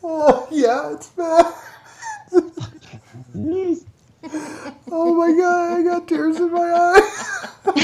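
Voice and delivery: high, helpless laughter; crying with laughter; laughing hard. Real, not acted. Laughing hard at fake robot voice.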